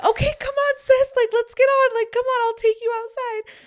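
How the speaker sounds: very high, loud sing-song coaxing; coaxing a bird. Retold or imitated. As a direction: sweet-talk a little bird in a high put-on voice. Coaxing the baby bird, retold.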